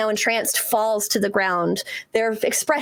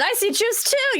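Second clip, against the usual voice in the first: much higher in disbelief; surprised; 13 semitones higher than usual. Real, not acted. The GM double-checks the player's rules, much higher. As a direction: squeak out the doubt. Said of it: "Wait a minute".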